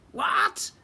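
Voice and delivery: high, loud shocked cry; shocked squeal. Acted. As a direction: squeal in disbelief. Prince shocked at sentence.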